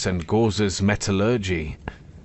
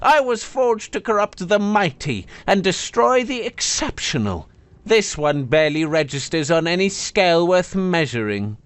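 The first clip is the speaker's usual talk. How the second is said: high, haughty complaining blade; disdainful sword; 8 semitones higher than usual. Acted. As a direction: grumble with wounded pride. Sword complains about Ren.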